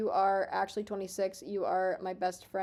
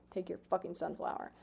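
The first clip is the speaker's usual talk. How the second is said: muttered; 3 semitones lower, 10 dB quieter than usual. Pretend. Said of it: "dropped to a mutter".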